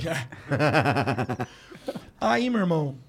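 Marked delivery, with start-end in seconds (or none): 0.2-1.4 loud, rapid staccato belly laugh. Real, not acted. Host's guest reacting to the tattoo joke, loud laugh. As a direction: burst out laughing hard.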